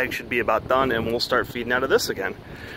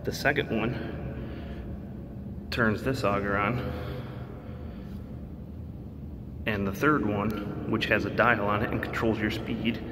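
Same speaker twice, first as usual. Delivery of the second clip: inside a silo; 9 dB quieter than usual. Real, not acted.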